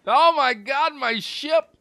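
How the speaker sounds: high, loud shriek of dismay; horrified cry. Real, not acted. Streamer cries out seeing his ship destroyed, horrified. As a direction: wail over the ruined ship.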